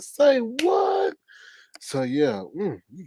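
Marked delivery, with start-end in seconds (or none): 0.0-1.1 shocked disbelief. Retold or imitated. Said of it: "I was like".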